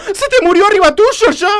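high, aghast exclamation; scandalised, voice leaping up; shocked. Acted. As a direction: blurt it out in horrified disbelief. Shocked at supposed death.

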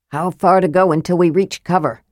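ordinary delivery

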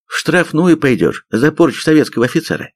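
high-pitched indignant threat. A narrator's reading. Major threatens sergeant with penal company, indignant. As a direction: threaten in offended outrage. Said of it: "с возмущением пообещал майор".

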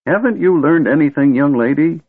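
scolding. A narrator's reading. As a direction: wag a finger at her. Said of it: "Fizban scolded".

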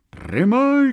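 high, loud yell; high-pitched crowd cry; answering shout. A narrator's reading. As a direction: shout back across a crowd. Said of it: "кричали в ответ первому".

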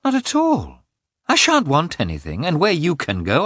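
high, indignant wife's rebuttal; piqued, insistent protest. A narrator's reading. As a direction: contradict hotly with pitch leaping up. Wife argues back in quarrel.

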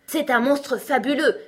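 high, loud exclamation; crying out. A narrator's reading. Unicorn cutting in before Alice replies, crying out. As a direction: blurt it out before anyone can answer. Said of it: "s'écria la licorne".

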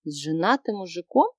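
ordinary delivery, nothing marked

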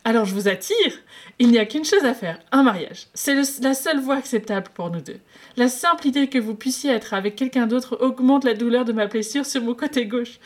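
cheeky lovestruck patient pushing his luck; playful flirt. Acted.

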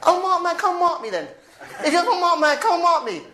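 mock bravado, daring him on; defiant challenge. Abu Izzuddin daring the stranger, high and laughing. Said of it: "He goes".

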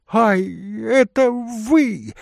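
high, loud exclamation; startled shout. Acted. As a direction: cry out on recognising someone. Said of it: "закричал господин в енотовой шубе". (громкий высокий вскрик)

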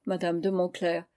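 usual speaking voice

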